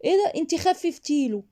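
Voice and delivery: high-pitched mock surprise; cold, obnoxious reply. Shown as a demonstration. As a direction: toss it back annoyingly, feigning surprise. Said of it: "فانت ردي برود سمجه ورزله".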